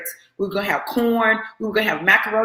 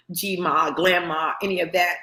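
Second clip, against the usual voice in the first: usual speaking voice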